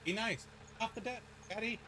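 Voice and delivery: high, soft coaxing to the cat; pet talk. Real, not acted. Talking sweetly to his cat.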